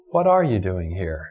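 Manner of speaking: ARE carries the weight, the rest low; low question, leaning on "are"; stress on ARE. Shown as a demonstration. Preacher asks God's question of Elijah, stress on ARE. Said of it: "emphasize a different word".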